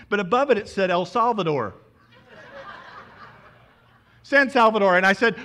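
loud, high, breathless retelling of the airport rush; excited preaching story. Acted. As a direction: preach the panic of running late at full force.

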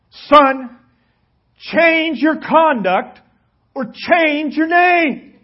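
very loud, slow, high ultimatum; the king shouts his verdict at the soldier; thundering command. A narrator's reading. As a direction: hammer each word like a furious general. King thunders final warning.

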